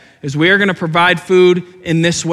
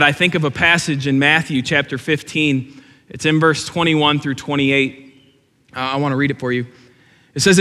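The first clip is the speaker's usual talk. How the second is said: normal talking voice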